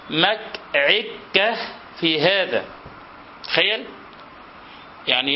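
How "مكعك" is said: "مكعك" drawn out very slowly, k replacing l; k for l lisp. Shown as a demonstration. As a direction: stretch "مكعك" slowly, k instead of l. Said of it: "قال".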